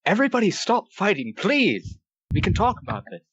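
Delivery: high-pitched desperate shout; pleading yell. Acted. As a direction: yell over a fight, begging them to stop. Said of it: "and just yell".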